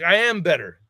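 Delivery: loud, high cocky comeback; a loud, boastful mock brag; boastful shout. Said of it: "And I was like".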